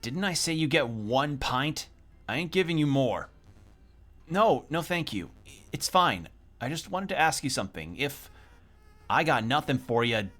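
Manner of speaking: ordinary delivery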